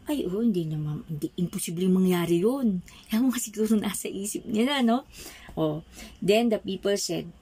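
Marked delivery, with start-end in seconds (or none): none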